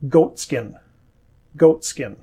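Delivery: goat skin with no T released before skin; T dropped. Shown as a demonstration. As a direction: goat skin, swallow the T completely. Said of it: "You can't hear that T 'cause I didn't say it".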